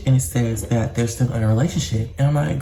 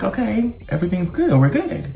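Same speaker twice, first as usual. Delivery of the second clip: mock reassured; 6 semitones higher than usual. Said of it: "No, bitch, we were not".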